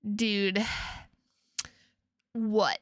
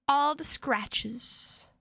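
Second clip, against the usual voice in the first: dramatic slow; 4 semitones higher, much slower than usual. Pretend.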